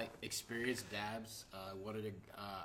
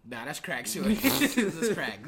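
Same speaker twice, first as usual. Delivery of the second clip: laughing; 7 semitones higher, 10 dB louder, slower than usual. Retold or imitated. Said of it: "I'm like".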